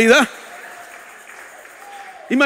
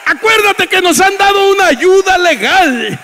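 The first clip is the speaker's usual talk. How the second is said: loud, high preaching shout; rally the congregation from the pulpit; fervent pulpit cry; preaching at full force; 6 semitones higher, 6 dB louder than usual. Acted.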